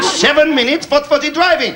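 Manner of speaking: high incredulous question; mock disbelief. Lorry driver amazed at the seven-minute turnaround, mock disbelief. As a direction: squeak it out in disbelief. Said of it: "He said".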